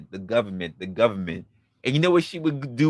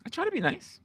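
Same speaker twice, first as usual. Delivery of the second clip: sweet high take; 9 dB quieter than usual. Shown as a demonstration. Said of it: "Just saying".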